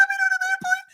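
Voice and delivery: piercingly high squeal of disbelief; astonished. Retold or imitated. Passenger amazed by an airplane kitchen, shrieking disbelief. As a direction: let the voice shoot up to a squeak.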